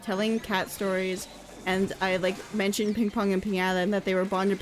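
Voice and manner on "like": normal talking voice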